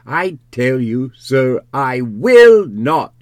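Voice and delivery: indignant refusal climbing to a loud, high peak; rising outraged protest. A narrator's reading. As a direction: build to a drawn-out, shouted refusal. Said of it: "his voice rising".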